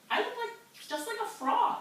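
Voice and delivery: high surprised guppy at reflection; astonished guppy. Acted. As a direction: realise it in a high amazed voice. Guppy sees his reflection.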